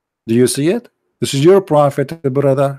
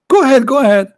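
taunting; 9 semitones higher, faster than usual. Real, not acted. Taunting opponent to mock prophet.

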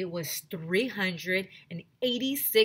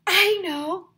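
much higher, loud exclamation; sudden bright idea; 12 semitones higher, 10 dB louder than usual. A narrator's reading. Girl suddenly struck by an idea, excited cry. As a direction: burst out with the answer.

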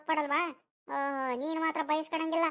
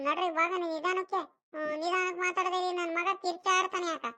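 put-on higher boyish voice, anxious nagging; worried, scolding boy Sunila; 4 semitones higher than usual. Sunila warns Chandu that grandma may hear, anxious scolding. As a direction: tell him off for being noisy.

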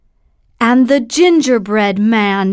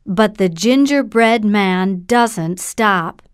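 usual speaking voice

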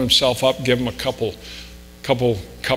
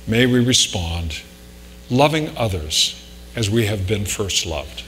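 prayer; 2 semitones lower than usual. Real, not acted. Pastor leads closing prayer.

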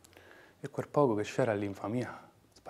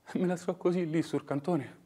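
usual speaking voice

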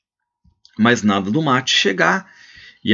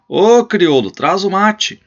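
booming call, much higher and louder; thunderous voice; 9 semitones higher, 5 dB louder, slower than usual. Acted. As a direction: bellow into the house for the mate. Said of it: "com uma voz de trovão".

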